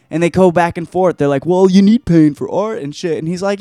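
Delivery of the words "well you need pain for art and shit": higher, louder mock protest; arguing objector. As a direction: whine back in mock earnest. Voices objector arguing back.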